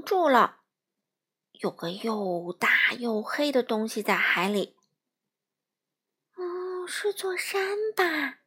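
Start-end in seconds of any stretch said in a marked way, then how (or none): 6.4-8.4 high, slow curious little fish; little fish wondering. Acted. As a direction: wonder aloud like a tiny fish. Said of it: "闪闪想着".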